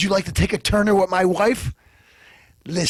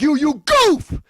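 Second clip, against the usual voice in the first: shouting insult; 8 semitones higher, 5 dB louder, much slower than usual. Acted.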